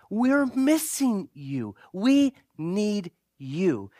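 We're and We leaned on, very slow and drawn out; slow, pleading stress on "need"; emphasis on need. Shown as a demonstration. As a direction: land each word slowly so they feel wanted. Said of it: "I put the emphasis on our need".

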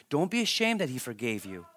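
ordinary talk

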